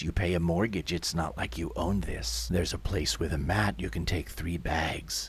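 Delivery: low, soft, impassive worker's voice; indifferent official. Acted. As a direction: dismiss them in a low, unmoved voice. Yellow vests answer shouting owners.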